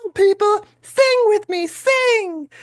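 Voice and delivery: very high rock-star call to the crowd. Retold or imitated. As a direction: belt it like a frontman leading a singalong. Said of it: "imitating [name] the way I do".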